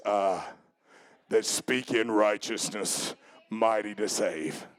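very low, slow, thundering-deep delivery; deep voice of God. Shown as a demonstration. Preacher showing how the Lord sounded answering, deep voice of God. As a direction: sink right down into a solemn, deep voice. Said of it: "I believe it sounded like this".

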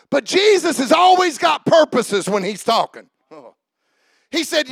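loud, rising preacher's cadence; full-force preaching with shouted peaks. Acted. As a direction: preach it out to the whole church.

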